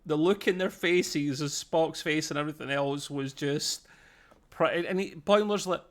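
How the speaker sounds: ordinary talk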